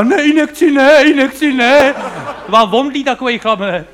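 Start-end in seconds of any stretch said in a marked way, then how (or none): 0.0-1.9 higher, pleading a touch hammed-up; a big tough hero panicking at the needle, higher; mock fainting hero. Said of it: "k němu jde doktor a říká". (trochu přehraný vyděšený hrdina, vyšším hlasem)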